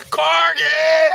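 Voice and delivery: very high, loud excited yell; excited shout. Real, not acted. Streamer greeting another corgi, high shout. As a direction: scream it with delight. Corgi arrives mid-question.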